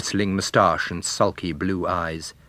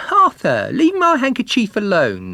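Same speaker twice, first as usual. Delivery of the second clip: put-on much higher, louder girl's voice; young woman; 13 semitones higher, 6 dB louder than usual. A narrator's reading. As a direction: snap at him like a young girl. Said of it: "the girl said".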